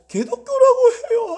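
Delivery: high pitched laugh through the insult; bitter laugh. Real, not acted. Pastor laughing bitterly at the slur against churches. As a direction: laugh it out in disbelief.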